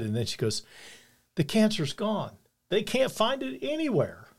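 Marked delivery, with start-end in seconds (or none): 1.4-4.3 pitched way up, slow and tearful-glad; retelling a friend's tearful cancer-free call, pitched way up; overjoyed news. Retold or imitated. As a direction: relay the miracle news with a leap in pitch. Friend's joyful phone news retold.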